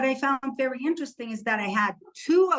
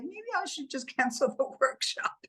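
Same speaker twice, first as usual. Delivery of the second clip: higher, laughing mock suggestion to cancel; kidding with mom; 5 semitones higher, 4 dB quieter than usual. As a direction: joke to mom about cancelling, laughing mid-line. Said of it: "I was just kidding with my mom".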